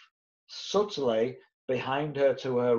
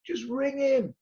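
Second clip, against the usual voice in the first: insistent pushing; 7 semitones higher than usual. Retold or imitated. Pushing agents to call landlord.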